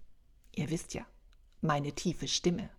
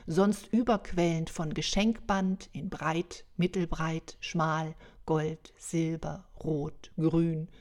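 ordinary delivery, nothing marked

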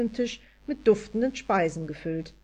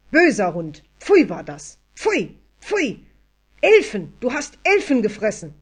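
beside herself; 3 semitones higher, 8 dB louder than usual. A narrator's reading. Anja scolds Odin for eating elves, beside herself. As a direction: shout each reproach at the dog. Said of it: "Sie war außer sich".

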